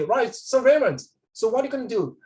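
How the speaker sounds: high, loud, careless reply to the boss; rude casual reply. Shown as a demonstration. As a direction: toss it off like you don't care. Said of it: "You cannot talk like that".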